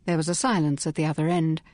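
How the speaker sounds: usual speaking voice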